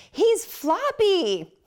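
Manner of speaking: high, slow, worried gasp of a voice; alarmed mother-in-law. Retold or imitated. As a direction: mimic a panicked grandmother.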